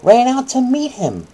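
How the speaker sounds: slow, high, loud bedtime-story reading; hammed-up children's Bible story voice; dramatic storytelling. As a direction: read it like a big dramatic bedtime tale.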